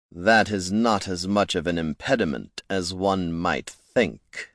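quiet, icy villain; cold, hollow voice. A narrator's reading. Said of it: "said a cold, hollow voice".

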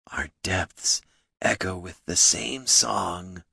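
soft, slow troll voice; wistful. A narrator's reading. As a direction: say it wistfully, drawing it out. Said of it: "he said wistfully".

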